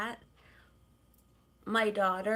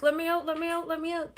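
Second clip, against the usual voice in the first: cat voice; 7 semitones higher, 4 dB louder, much faster than usual. Retold or imitated. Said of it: "He's like".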